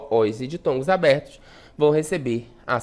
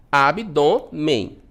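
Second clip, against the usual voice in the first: "Abdômen" counted out as Ab, dô, men; slow, three separate beats on "Abdômen"; syllable by syllable; 3 semitones lower, much slower than usual. Shown as a demonstration. Teacher counting three syllables for pupils, syllable by syllable. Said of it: "eu tenho três sílabas".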